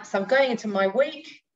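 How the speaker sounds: ordinary talk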